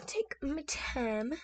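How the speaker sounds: whisper. Real, not acted. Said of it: "whispered to herself".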